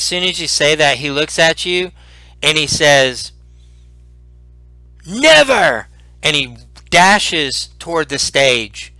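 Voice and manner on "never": high-pitched defiant outburst; defiant cry. Acted. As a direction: cry out your refusal. Old man defiantly refuses.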